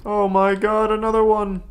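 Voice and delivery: high, loud cry of alarm; alarmed outcry. Real, not acted. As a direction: cry out at yet another scare. Reacting to another scare in game.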